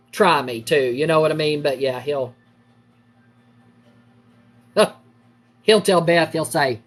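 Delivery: ordinary delivery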